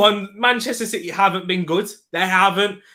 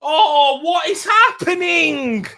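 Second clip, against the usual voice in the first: shocked shout; 9 semitones higher, slower than usual. Real, not acted. Fan shouting at the TV over the red card, shocked. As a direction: yell out at the screen in shock.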